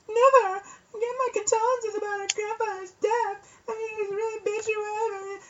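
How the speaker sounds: fake crying girl. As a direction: sob falsely to the teacher. Bully fakes crying to teacher.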